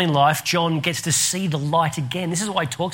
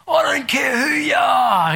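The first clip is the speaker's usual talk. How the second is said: high, furious farmer's yell; hammed-up outburst, pitched way up; imitated angry shout; 9 semitones higher, 3 dB louder than usual. Landowner rebuffs Grills's friendly introduction, angry high shout.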